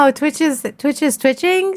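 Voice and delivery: high, teasing disbelief; joking, put-on astonishment; mock surprise. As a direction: raise the pitch in playful shock. Joking about glitching Twitch.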